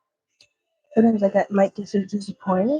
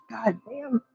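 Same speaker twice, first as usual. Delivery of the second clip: ordinary talk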